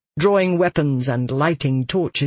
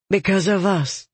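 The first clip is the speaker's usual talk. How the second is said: a touch of regret, slightly raised pitch; regretful; 4 semitones higher than usual. A narrator's reading. Winter admitting they caused the attack, regretful. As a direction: let mild regret into the admission. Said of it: "her tone filled with regret".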